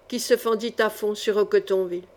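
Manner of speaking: usual speaking voice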